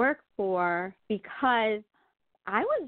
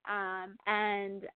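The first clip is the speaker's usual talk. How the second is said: usual speaking voice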